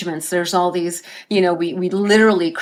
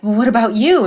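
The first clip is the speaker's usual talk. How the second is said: pleading cry; 4 semitones higher, 6 dB louder, faster than usual. Retold or imitated. Father pleading with his wife.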